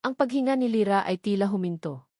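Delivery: ordinary talk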